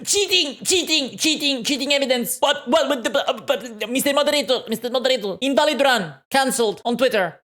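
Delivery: high, frantic cries of cheating; mock outrage. Viewer accusing the player to the moderator, mock outraged.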